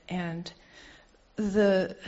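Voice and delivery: ordinary delivery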